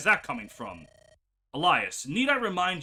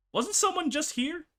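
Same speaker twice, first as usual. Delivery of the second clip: much higher puzzled voice; mock confused; 11 semitones higher than usual. Players mocking the erased traitor, high and puzzled. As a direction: play dumb, as if he never existed. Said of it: "we're all gonna be like".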